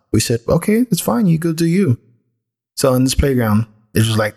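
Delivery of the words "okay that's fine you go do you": high-pitched, indulgent retold reply; easygoing parental okay. Parent agreeing to let daughter play by herself, easygoing.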